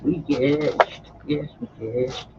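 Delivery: plain reading voice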